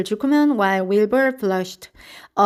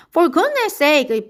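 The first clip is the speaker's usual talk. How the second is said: higher, louder outburst; bellowed; 6 semitones higher, 4 dB louder than usual. A narrator's reading. Soaked Zuckerman bellows at Lurby, loud and raised. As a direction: bellow it out, drenched. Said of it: "bellowed Mister Zuckerman".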